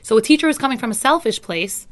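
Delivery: ordinary talk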